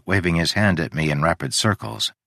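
plain reading voice

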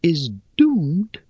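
high, airy, scornful little voice; airy spider voice. Acted. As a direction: chide them, light and dry.

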